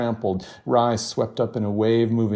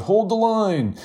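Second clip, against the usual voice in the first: high rallying call from the crowd; 12 semitones higher, 3 dB louder than usual. A narrator's reading. Protester in the crowd urging others to hold firm, rallying call. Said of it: "a voice in the crowd called out".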